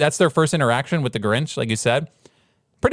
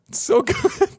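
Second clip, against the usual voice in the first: laughing; 8 semitones higher, slower than usual. Real, not acted. Laughing about eggnog.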